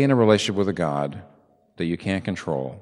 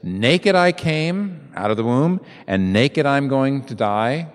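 slow; 5 semitones higher, 4 dB louder, slower than usual. Retold or imitated. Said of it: "He says".

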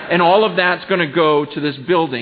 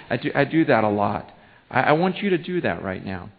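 normal talking voice

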